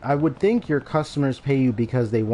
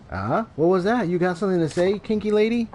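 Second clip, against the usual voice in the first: higher, jeering thug voice; taunting thug; 6 semitones higher than usual. Acted. Thug jeering at the timid dominatrix, mocking. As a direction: sneer it down at her.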